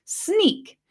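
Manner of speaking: mock-hobbit voice, second take leaping high; mocking quote. Gollum mimicking the hobbits' insult, sneering and high. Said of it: "and they say".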